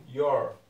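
"Your" said loud and clear as "yor"; loud model word. Shown as a demonstration. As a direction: hit "Your" loudly for the class. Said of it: "É a mesma dicção". (palavra "Your" pronunciada alto e clara)

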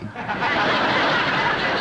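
a loud, hearty laugh. Real, not acted. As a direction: burst out laughing at her own joke. Laughs at own joke.

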